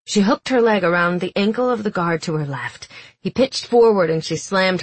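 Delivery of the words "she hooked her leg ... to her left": ordinary delivery, nothing marked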